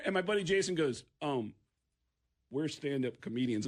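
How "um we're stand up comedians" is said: lowered, hesitant admission, imitating a friend; sheepish answer. Opening act explaining himself to police, sheepish. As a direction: pause after the um, then answer meekly.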